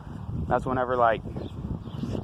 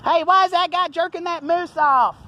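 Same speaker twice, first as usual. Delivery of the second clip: high onlooker voice; 7 dB louder than usual. Retold or imitated. Vlogger voicing staring strangers, high and scandalized. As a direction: play a shocked onlooker, pitched way up.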